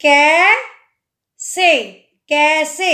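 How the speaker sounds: कैसे with a long open कै, very slow; drawn-out ai. Shown as a demonstration. Teacher stretching कै in कैसे. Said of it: "इसमें दोनों मात्रा लग रही हैं".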